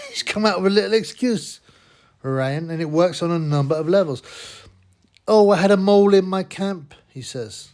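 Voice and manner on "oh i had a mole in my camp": loud mocking quote of the boxer; mocking whine. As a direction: sneer out his excuse, louder. Mocking Ryan's excuse.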